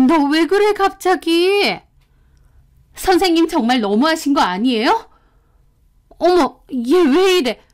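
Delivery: indignant woman teacher, high and put-upon; flustered teacher. Retold or imitated. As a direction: play the caught teacher acting offended. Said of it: "도리어 황당한 표정".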